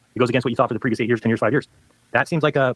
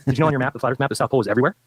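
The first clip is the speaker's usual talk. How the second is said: ordinary delivery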